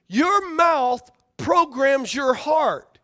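Your mouth programs your heart, drawn out slowly word by word; slow emphasis. Shown as a demonstration. Preacher driving home his key point, very slow. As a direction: lay out each word slowly for weight. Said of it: "This is a big key".

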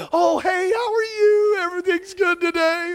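high, bright mock greeting; cheery greeting. Preacher acting out a cheerful hello. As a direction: put on a fake-happy hello.

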